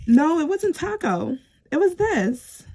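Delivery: high, excited correction. Real, not acted. Vlogger corrects the card she pulled, high and excited. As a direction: catch yourself, pitch jumping up. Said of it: "I'm still getting that excited".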